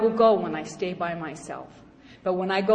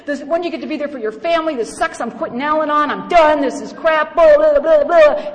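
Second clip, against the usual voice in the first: high-pitched furious complaining, louder at the end; retold phone tantrum, pitched up and getting louder; angry rant; 7 semitones higher, 4 dB louder than usual. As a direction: vent to the sponsor like a wronged child. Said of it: "and I am mad, angry, angry, angry".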